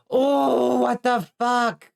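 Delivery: high, drawn-out disbelief; shocked outcry. Real, not acted. Stunned by the better sword find.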